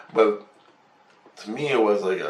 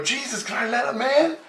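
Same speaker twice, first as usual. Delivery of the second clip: high-pitched mock pleading; acted urgent question, pitched way up; imitated plea. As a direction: plead in a squeaky put-on voice. Acting out his plea at window.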